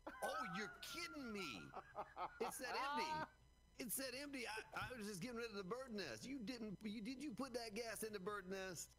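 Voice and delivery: high-pitched incredulous protest; exasperated disbelief. Real, not acted. Discovers the gas was lost.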